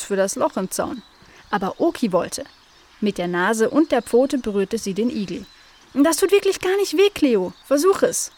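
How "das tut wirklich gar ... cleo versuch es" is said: high, lively urging; encouraging. A narrator's reading. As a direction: urge a hesitant sister on, high and louder. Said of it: "ermutigte sie ihre Schwester". (hoch und ermutigend)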